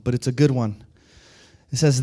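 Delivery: usual speaking voice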